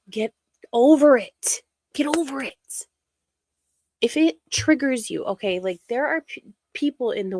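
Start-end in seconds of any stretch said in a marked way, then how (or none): 0.1-1.6 louder, pushed out, fed up; exasperated. Real, not acted. Ranting at online critics, loud and fed up. 1.9-2.9 breathy whispered repeat, no voice. Real, not acted. Muttering to herself about the critics, whispered.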